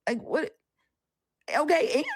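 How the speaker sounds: high, loud defiant retort; sassy comeback to a believer in chat; defiant sass. Retold or imitated. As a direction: throw the anger back, unashamed. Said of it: "I'm like".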